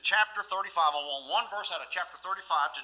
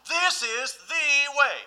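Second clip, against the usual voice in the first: slow emphatic refrain; 8 semitones higher, 6 dB louder, much slower than usual. Acted.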